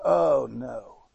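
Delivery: low, dismayed groan of oh no. Shown as a demonstration. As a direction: sink into a low oh no. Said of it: "what I do say is".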